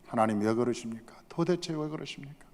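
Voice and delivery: soft, bewildered appeal; hushed pleading. Acted. Samuel asks angry God why, hushed pleading. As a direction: plead quietly, dropping toward a whisper.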